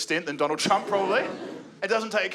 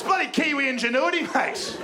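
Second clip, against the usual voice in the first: mock Kiwi bloke; 7 semitones higher than usual. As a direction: brag like a proud ocker Kiwi.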